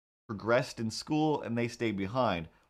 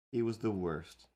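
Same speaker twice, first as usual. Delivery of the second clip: ordinary delivery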